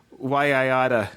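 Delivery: a hint of New York tough guy; mock New York threat, why I oughta; New York accent. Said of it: "I love that New York accent".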